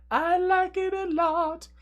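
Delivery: very high, loud, drawn-out singsong; playful singsong. Pretend. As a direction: stretch it out in a silly high held tone. Playful singsong relish of card.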